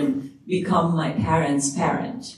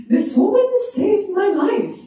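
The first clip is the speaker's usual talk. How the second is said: sobbing mother; 3 dB louder than usual. Retold or imitated. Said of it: "she says in Persian".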